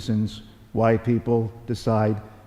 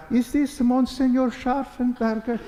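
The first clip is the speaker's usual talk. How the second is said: formal caller; 13 semitones higher than usual. Retold or imitated. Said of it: "Turned out it was Archbishop Vigano".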